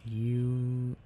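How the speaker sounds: ordinary talk, nothing marked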